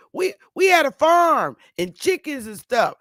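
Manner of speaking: normal talking voice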